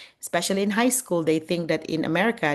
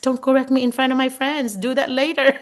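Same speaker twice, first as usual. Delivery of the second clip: embarrassed plea; 8 semitones higher, 4 dB louder than usual. Retold or imitated. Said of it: "and then I s-, just".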